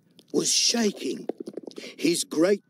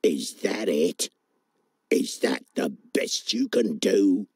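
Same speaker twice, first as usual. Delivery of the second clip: low, scornful frog voice; unimpressed frog; 7 semitones lower than usual. Acted. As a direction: sneer it low like a bored frog.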